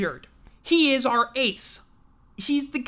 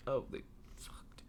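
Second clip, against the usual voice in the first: soft whispered curse; whispered aside; 10 semitones lower, 20 dB quieter than usual. Real, not acted. Reading scores to himself, whispered aside. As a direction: drop to a whisper.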